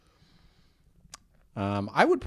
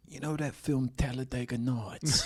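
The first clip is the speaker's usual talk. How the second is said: hushed Statham voice; 4 dB quieter than usual. Retold or imitated. Statham voice, soft.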